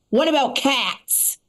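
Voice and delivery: slow, drawn-out imitation of an indignant stranger; a hint of a mad lady's accusing tone, slow; mild mock anger. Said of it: "She's mad".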